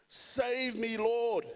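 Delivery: a hint of a sinking man's shout; shouted plea. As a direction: cry out as if sinking. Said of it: "he shouted".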